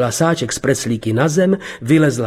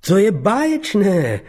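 slow, higher, overly bright praise, not meant; mock delight, clearly hollow; insincere; 8 semitones higher than usual. A narrator's reading. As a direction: fake it, say it like you don't mean it. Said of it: "pronesl neúpřímně".

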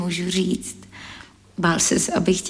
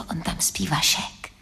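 breathy warning whisper under the breath; hushed whisper; 7 dB quieter than usual. Acted. She warns Lojza her Vašek is asleep, in a whisper. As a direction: whisper so the sleeper won't wake.

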